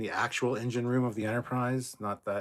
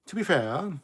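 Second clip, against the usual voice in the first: "To be fair" repeated much higher and louder; 8 semitones higher, 6 dB louder, slower than usual. Shown as a demonstration. Cohost repeating for emphasis, high and loud.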